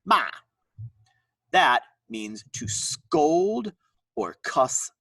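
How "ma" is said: ma with a sharp downward drop; falling tone. Shown as a demonstration. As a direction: snap ma down hard. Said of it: "with a sharp downward inflection".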